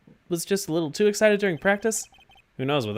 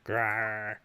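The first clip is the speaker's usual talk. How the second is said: tiger growl; 7 semitones lower, 9 dB quieter than usual. Acted.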